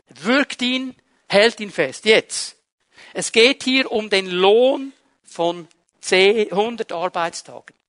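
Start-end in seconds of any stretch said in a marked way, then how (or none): none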